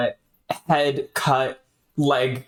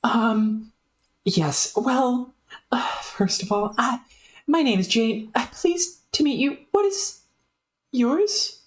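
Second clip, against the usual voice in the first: higher, prim introduction; higher voiced well-mannered girl; polite Jane; 12 semitones higher than usual. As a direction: introduce yourself courteously.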